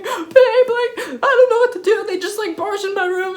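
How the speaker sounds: high-pitched panicky imitation; retold freaking out. Woman calling her boyfriend after intruders, panicky. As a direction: act out a tearful, panicked phone plea. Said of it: "freaking out".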